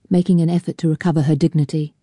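ordinary delivery

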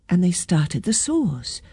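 plain reading voice

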